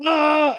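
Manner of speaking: high, loud imitated panicked scream "Ah!"; mock scream. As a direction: let out a hammed-up panicked yelp. [name] screams while running.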